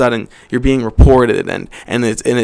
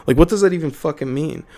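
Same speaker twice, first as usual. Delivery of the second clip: ordinary talk, nothing marked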